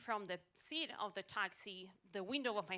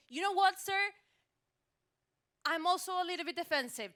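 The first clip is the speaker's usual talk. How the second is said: loud, high, worked-up retort; indignant; 9 semitones higher, 9 dB louder than usual. Acted. Passenger answering the driver's apology, indignant. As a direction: argue back at full voice.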